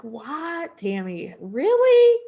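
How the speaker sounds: rising disbelief, last word shot up high and loud; incredulous self-scolding. Retold or imitated. Scolding herself for panic buying, rising disbelief. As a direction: catch yourself and ask, really?.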